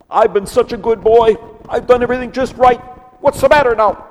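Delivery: high, whiny self-pitying voice; whimpering Elijah. Acted. Elijah whimpers to God from the cave, high and whiny. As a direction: whine it high like a pouting child. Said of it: "and he whimpers".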